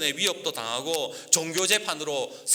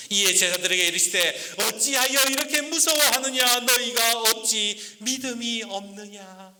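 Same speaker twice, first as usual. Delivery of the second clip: Jesus rebuking; 7 semitones higher, 4 dB louder than usual. Acted. Jesus rebukes frightened disciples. (제자들을 꾸짖는 예수님 목소리, 높고 느리게)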